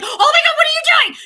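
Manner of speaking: high, loud horrified mother; mock mother shriek. Mother scolding daughter near baby, mock shriek. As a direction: snap at the child like a shocked mother.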